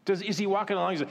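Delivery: ordinary talk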